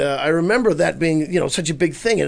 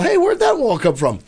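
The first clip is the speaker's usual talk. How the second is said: mock surprise; 12 semitones higher, 4 dB louder than usual. Joke bit, mock surprise.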